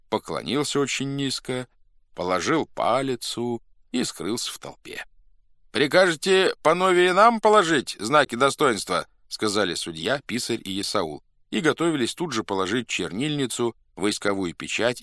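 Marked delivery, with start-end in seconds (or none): 5.7-9.1 high, loud formal question; calling to a crowd. A narrator's reading. Officers ask the Cossacks about their insignia, calling out. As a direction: put it loudly to the assembly.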